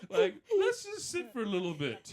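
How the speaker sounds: high, loud and laughing through the words; giddy retort. Retold or imitated. Husband mocks the doctor's order, loud laughing shout. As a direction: shout it back at the doctor, cracking up. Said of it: "I'm like".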